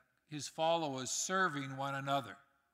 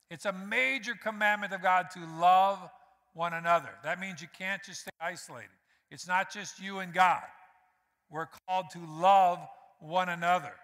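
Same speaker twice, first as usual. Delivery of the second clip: louder, building sermon emphasis; preaching; 6 dB louder than usual. Acted. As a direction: press each point home to the church. Preaching at full force.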